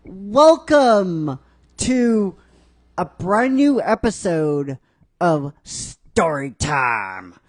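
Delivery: slow, drawn-out show announcement; announcer intro. Acted. Host announcing the new episode, slow and drawn out. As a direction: stretch it like a game show host.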